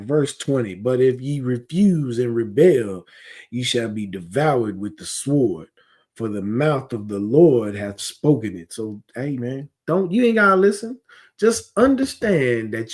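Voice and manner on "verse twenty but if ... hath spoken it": scripture reading. Real, not acted. Preacher restarting the verse for his listeners, measured and grave. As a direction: read the verse through, steady and grave. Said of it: "So let me start that over".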